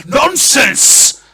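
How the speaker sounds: loud, high dismissal; outraged lord cutting her off; explosive, indignant cry; furious shout. Acted. Verence shouts down the hostess.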